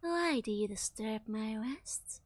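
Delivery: breathy, slow seductive whisper; sultry whisper. Acted. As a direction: purr it out slowly, seductive. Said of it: "speaks in a sultry voice".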